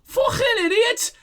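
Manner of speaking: very high, drawn-out brat jeer; jeering kid. Acted. Kid jeering at the lieutenant's missed shot, jeering. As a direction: squeal the insult slowly.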